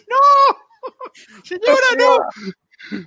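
high, loud horrified wail; mock outcry. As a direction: cry out in mock horror.

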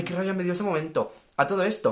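ordinary delivery

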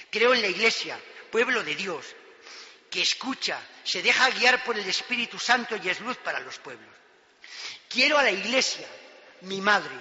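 solemn declaration of faith; fervent sermon creed; preaching. Acted. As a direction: proclaim it from the pulpit.

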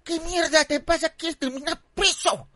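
high, scolding mock scream; yelling mother. Mother stopping her son from stabbing neighbour, high screamed scolding. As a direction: yell like a mom grabbing her furious son. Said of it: "me agarra y me grita y me dice".

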